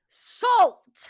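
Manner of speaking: loud, high single-word outburst; exasperated repeat. Acted. Customer repeats the order at drive-through, exasperated. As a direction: belt the word out at a baffled server.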